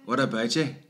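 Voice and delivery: casual Northern Irish greeting; Northern Irish hello from back home. Shown as a demonstration. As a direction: model it in a Northern Irish accent. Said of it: "that's what they sound like back home".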